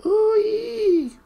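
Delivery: very high, loud drawn-out squeal. A narrator's reading. Captured gnome to his captors, squealing. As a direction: squeal like a caught little creature. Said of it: "squealed the Earthman".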